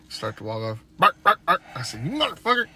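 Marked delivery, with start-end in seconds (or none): 1.0-1.6 loud, high spoken barks; imitated barking. Owner mimicking his dog starting up, imitated barking. 2.0-2.7 high, loud exasperated outburst; mock cursing. Owner retelling cursing his barking dog, mock anger.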